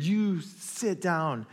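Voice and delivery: unhurried stern order; calm rebuke. Acted. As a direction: quietly put the storm in its place. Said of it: "And rebuke".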